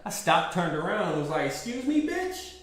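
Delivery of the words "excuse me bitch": high-pitched, incredulous retort; mock indignant. Stops, turns round, retold outrage.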